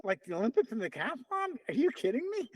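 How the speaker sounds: high-pitched retold disbelief; incredulous. Buddy reacts to friend's Olympic plan, incredulous. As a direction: question it in rising amazement.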